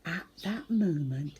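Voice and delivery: usual speaking voice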